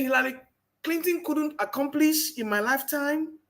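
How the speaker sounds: ordinary delivery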